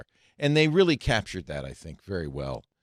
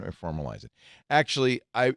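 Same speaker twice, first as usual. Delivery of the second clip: ordinary delivery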